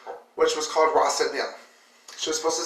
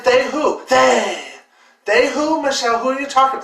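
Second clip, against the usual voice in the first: higher, louder pressing question; frustrated questioning of a cousin; exasperated asking; 8 semitones higher, 5 dB louder than usual. Retold or imitated. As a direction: push her for a real answer. Pressing Michelle for an answer.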